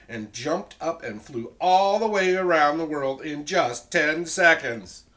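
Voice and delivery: loud, animated storybook reading; story narration. A narrator's reading. Teacher reads the dragon's flight to children, loud and animated. Story read at full volume.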